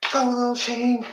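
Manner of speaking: high cheering mom voice. Retold or imitated. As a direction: cheer him on like a proud mom. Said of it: "She'd be out here".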